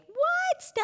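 high-pitched, astonished "what?"; mock surprise. Host reacts to National Sean Day, mock surprise. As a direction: squeal it in playful disbelief.

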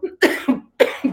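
sudden coughing fit; choking cough. Real, not acted. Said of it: "me ahogué con el".

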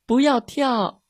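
put-on high, urgent child's cry; shouting. A narrator's reading. Girl warning the dog at the river, shouting. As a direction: yell a warning, pitched high. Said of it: "米莉大叫".

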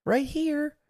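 high, softened falsetto call; imitated calling out. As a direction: mimic someone calling from a distance. Retold wife calling out son is here.

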